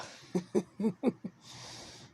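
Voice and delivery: loud, high mocking cackle; maniacal cackle. Man laughing at the vampire wife, loud high cackle. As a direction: cackle in her face.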